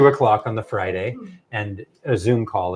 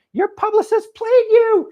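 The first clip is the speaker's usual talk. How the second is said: very high, loud mock exclamation; Oprah Winfrey; 9 dB louder than usual. Star telling the author of the trick, imitated gleefully. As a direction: crow it loudly, way up high. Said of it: "she said".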